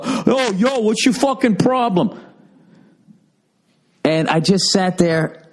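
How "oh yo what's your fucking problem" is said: high, laughing mock of a tough guy; tough guy imitation. Mocking the husband who would confront him, a laughing tough-guy voice. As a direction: lightly play the husband squaring up. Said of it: "have to fucking go".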